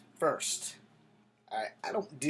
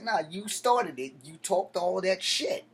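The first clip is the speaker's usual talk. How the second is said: heated retort; 5 semitones higher, 5 dB louder than usual. Retold or imitated. Telling off the old man.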